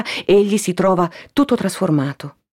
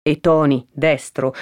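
plain reading voice

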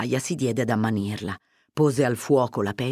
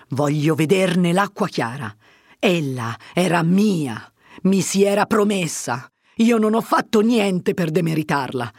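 Fermo, wounded and insistent; 3 semitones higher, 4 dB louder than usual. Acted. Fermo tells Agnese Lucia was promised to him, wounded and insistent. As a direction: press it like a jilted lover who won't let go.

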